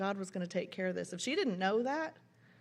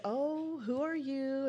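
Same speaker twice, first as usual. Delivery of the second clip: high, drawn-out playful greeting; husband greeting children; 5 semitones higher, much slower than usual. Retold or imitated. Husband to costumed children on the platform, playful greeting. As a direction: imitate a grown-up cooing over a costumed child. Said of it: "my husband would say".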